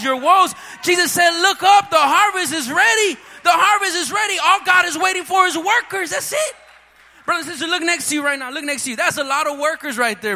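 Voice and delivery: loud, high, impassioned preaching; shouted sermon climax; full-force preaching. Real, not acted. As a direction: rouse the whole congregation. Closing altar call at full force.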